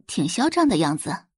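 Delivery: ordinary delivery, nothing marked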